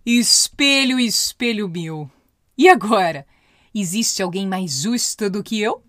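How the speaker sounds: loud, drawn-out summons to the mirror; imperious story queen; evil queen. A narrator's reading. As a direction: demand an answer as a commanding queen. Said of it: "a rainha foi em direção ao espelho mágico e perguntou".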